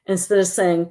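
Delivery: normal talking voice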